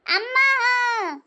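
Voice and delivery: high, slow, whimpering "अम्मा हो"; whiny drawn-out call. Acted. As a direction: wail for her mother like a sulky child. Daughter calls sleeping mother.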